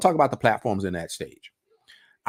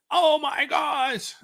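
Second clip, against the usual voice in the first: very high, drawn-out imitation of someone aghast; hammed-up, slow exclamation; emphatic mock outcry; much slower than usual. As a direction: stretch it out in a high-pitched mocking wail. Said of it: "I just heard a guy emphatically come on and say".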